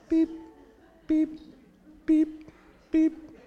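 short high electronic beeps; little high-pitched video game blips; imitated game beeps. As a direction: mimic Pong with evenly spaced high beeps. Imitates sound of Pong game.

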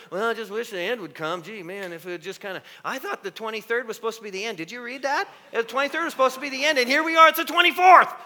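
whiny impatient believer, high and loud; mock the ones waiting for the end, rising to a shout; mock whiner.

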